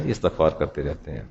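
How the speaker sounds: hushed repeated answer. Shown as a demonstration. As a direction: echo the answer quietly, almost to yourself. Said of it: "کیا کرتے ہیں؟".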